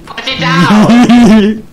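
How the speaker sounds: drawn-out pleading yell; urgent shouting. Real, not acted. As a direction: stretch each word, begging loudly. Yelling at teammate.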